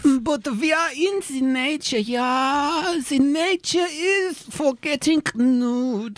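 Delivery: put-on thick German tourist accent. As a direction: play a blunt German nudist. Tourist voice, slow last line.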